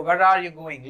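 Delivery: Where as one short syllable, linked to are; natural spoken pronunciation. Shown as a demonstration. Teacher showing how people talk, short Where. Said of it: "लोग ऐसे ही बोलते हैं".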